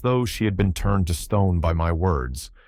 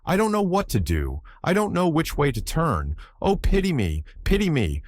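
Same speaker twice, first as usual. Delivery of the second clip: high-pitched despairing plea; anguished cry; 9 semitones higher than usual. A narrator's reading. Girl sobbing on the floor begs for pity, anguished high cry. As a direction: plead desperately, voice pitched far up. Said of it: "she cried".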